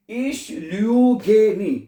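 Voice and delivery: ich lüge nie, each word drawn out slowly; slow teaching demo. Shown as a demonstration. Teacher to Hindi-speaking students, slow and deliberate. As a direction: spell it out slowly for learners. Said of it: "मैंने बताया है कि ich के साथ".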